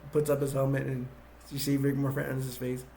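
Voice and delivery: ordinary delivery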